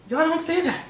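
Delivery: high laughing disbelief, breaking into giggles. Retold or imitated. Retelling coworkers teasing, laughing.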